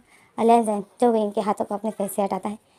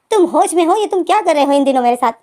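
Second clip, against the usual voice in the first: raised, louder, indignant questioning; angry confrontation; 5 semitones higher, 4 dB louder than usual. Retold or imitated. Wing gripping Lenzen's shoulder and confronting him, retold anger. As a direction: demand an explanation, mildly heated. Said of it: "तुम क्यों इतने गुस्सा हो रहे हो?".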